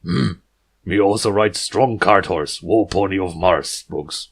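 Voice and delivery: chest-thumping jungle hero, terse and loud; jungle stallion talk. Acted. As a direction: puff up and speak in blunt caveman words. Balrus answers tersely.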